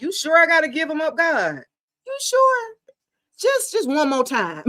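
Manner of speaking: high-pitched, mildly imitating someone hesitant; mock pleading. Acting out client pleading about ex.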